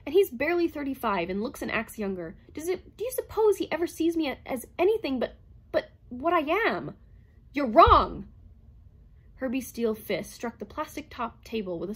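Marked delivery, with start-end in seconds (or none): 0.0-7.0 higher and louder, bitter rush; bitter, breathless. A narrator's reading. Calvin venting to the robot, bitter and breathless. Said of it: "She drove on with bitter breathlessness". 7.5-8.3 forceful shout; loud protest. A narrator's reading. Herbie contradicting Calvin with a sudden shout. Robot protests, fist strikes table.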